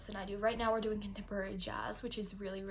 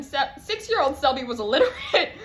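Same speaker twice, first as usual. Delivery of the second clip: laughing; 6 semitones higher, 13 dB louder than usual. Real, not acted. Laughing at childhood reading.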